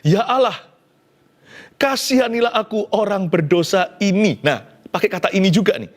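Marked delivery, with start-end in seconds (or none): 0.1-4.4 lightly voiced, higher pleading plea; tax collector's prayer. Preacher voicing the repentant tax collector, pleading. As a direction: plead with God, raised and louder. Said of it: "mari kita lihat perkataannya".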